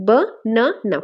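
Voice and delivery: ব, না, না with a pause after each syllable. Shown as a demonstration. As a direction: break banana into three separate beats. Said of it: "syllable division করতে লাগবে".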